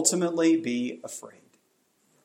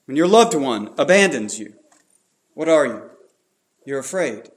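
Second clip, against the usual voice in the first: normal talking voice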